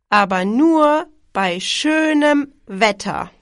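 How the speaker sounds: ordinary talk, nothing marked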